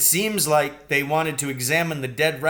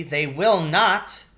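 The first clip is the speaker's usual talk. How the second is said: indignant refusal; 6 semitones higher, 8 dB louder, much slower than usual. A narrator's reading.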